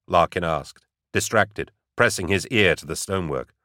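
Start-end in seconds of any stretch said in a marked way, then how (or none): none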